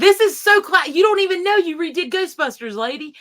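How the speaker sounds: high, loud gushing praise; excited gushing. Retold or imitated. To Pat about her test idea, a loud, high, excited gush. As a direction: gush like a delighted fan. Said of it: "and we're like".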